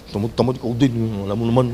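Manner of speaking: put-on low, soft, sulky kid's reply; lazy teen talking back. Teen brushing off his nagging mother, low sulky mumble. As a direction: mumble it like a lazy teenager shrugging off mom.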